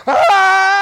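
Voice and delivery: put-on high, loud child's shriek; sharp high yelp; imitated child scream. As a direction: scream like a toddler about to be paddled. Boy grabbed for paddling.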